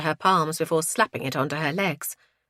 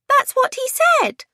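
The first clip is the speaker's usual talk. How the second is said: put-on high, loud, slow child's protest; drawn-out childish protest; 7 dB louder than usual. A narrator's reading. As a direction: insist loudly in a little kid's high voice. Child protests after being corrected.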